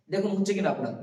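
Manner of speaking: ordinary talk, nothing marked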